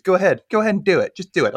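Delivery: egging her rival on to try; mock loud dare.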